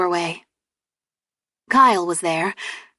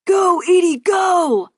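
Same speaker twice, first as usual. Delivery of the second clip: high excited shout; screaming; 11 semitones higher, 4 dB louder, slower than usual. Acted. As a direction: scream encouragement at the runner. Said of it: "Kyle screamed".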